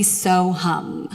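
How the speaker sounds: lightly chanted mantra demo. Shown as a demonstration. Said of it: "mantra that I'm gonna have you silently repeat".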